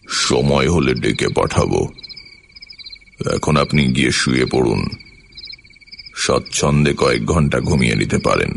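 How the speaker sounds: low, curt order from a raja; deep voice of a king giving orders; clear command. A narrator's reading. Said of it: "পরিষ্কার আদেশের মতো শোনাল".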